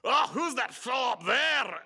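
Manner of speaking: high, drawn-out mock Scottish accent; poor Scottish accent. Ted goofing at a London convention, mock Scottish accent. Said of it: "rather a poor Scottish accent".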